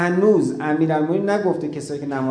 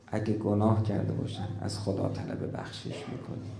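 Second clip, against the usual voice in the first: hushed solemn whisper; 6 semitones lower, 6 dB quieter than usual. Acted. Imam speaks of seeking forgiveness.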